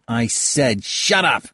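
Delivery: slow, insistent angry repeat. Acted. Halbert, repeating himself, angrily orders Kayada silent, slowly. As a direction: bite out each word.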